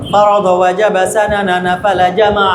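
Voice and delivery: whole line run off in one breath; quick, unbroken rote recital; rapid recitation. Shown as a demonstration. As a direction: rattle the words off in a low, even drill. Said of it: "আমাকে কিন্তু এভাবে দ্রুত শোনাইতে হবে".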